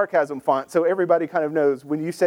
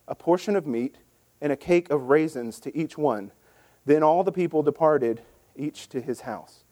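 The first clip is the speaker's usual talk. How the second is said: measured, lower scripture reading voice; formal Bible reading, lower than his chat; 5 semitones lower than usual. Acted. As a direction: read the passage steadily, like a lesson from the pulpit. Reads scripture passage.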